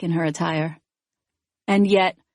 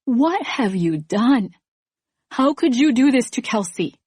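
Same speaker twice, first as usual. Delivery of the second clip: demanding; 5 semitones higher than usual. Pretend. Narrator imagines confronting Esther, a rising accusing demand. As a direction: pretend confronting, pitch climbing higher. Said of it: "demand of her".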